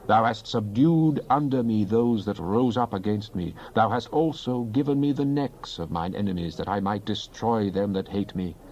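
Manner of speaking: ordinary delivery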